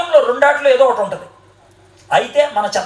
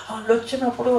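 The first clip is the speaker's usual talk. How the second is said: mock timid mumble; 7 dB quieter than usual. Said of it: "గట్టిగా మాట్లాడలేరు".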